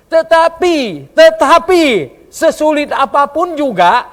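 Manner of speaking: loud, high, forceful preaching; full-force preaching. Acted. Preacher challenging the congregation to bear witness, shouting. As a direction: drive the call home to the church.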